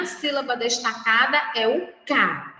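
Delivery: normal talking voice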